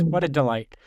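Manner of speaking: ordinary talk, nothing marked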